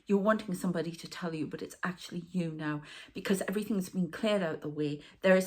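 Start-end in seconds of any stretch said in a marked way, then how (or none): none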